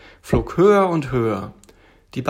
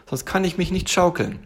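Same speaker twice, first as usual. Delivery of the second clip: high little tiger voice; demanding little tiger; 7 semitones higher than usual. Acted. Tiger wants a rocking chair first, insistent.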